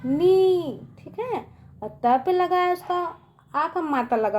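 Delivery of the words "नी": नी with a long ee, higher and louder; pronunciation demo. Shown as a demonstration. Teacher shows children the long matra, नी held long and loud. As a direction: stretch नी out firmly. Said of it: "उसका उच्चार कैसे करेंगे?".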